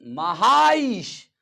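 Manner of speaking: "महाईश" drawn way out with both long vowels; slow, high teaching demo of महाईश; drawn-out pronunciation. Shown as a demonstration. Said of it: "जब इस शब्द का उच्चारण तुम करोगे".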